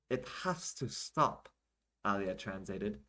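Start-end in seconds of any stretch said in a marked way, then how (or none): 0.1-1.5 slow, higher-pitched insistence; emphatic translation. Acted. Alia translates the woman's rant, slow and emphatic. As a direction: spell it out slowly, pitched up.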